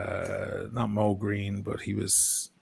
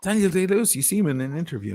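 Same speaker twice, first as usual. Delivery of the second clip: ordinary talk, nothing marked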